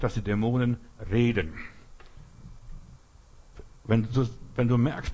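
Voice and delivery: ordinary talk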